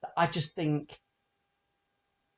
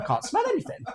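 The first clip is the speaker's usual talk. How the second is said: Joe's denial; faster than usual. Retold or imitated. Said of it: "And Joe's like".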